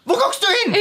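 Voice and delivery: very high-pitched acted question; mock-baffled priest. Priest copies her look to camera, mock-baffled high squeal. Macht so.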